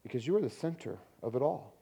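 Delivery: soft, low prayer with pauses. Real, not acted. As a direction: pray quietly and unhurriedly, settling down into amen. Closing prayer before the sermon.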